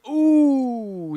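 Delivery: high, loud amazed ooh; excited ooh. Real, not acted. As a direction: react to a huge move. Big move lands.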